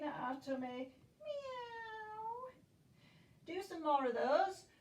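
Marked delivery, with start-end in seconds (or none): 1.2-2.5 high, soft, rising kitten meow; mewing like a cat. Pretend. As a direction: squeak it out like a tiny cat. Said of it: "Into our cat".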